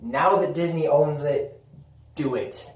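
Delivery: ordinary talk, nothing marked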